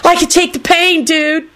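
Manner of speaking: high-pitched acted holler; triumphant holler. A narrator's reading. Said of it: "Kenny hollered back triumphantly".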